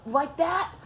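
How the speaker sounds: high, rising mock challenge; imitated confrontational question; retold challenge. Friend challenges the ex.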